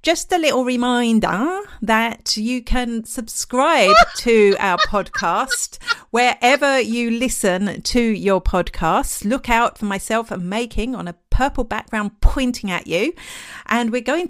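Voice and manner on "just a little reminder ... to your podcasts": slow, mock-posh English with a laugh; posh accent. Shown as a demonstration. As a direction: lay on a posh accent, every word stretched. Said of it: "I've gone posh".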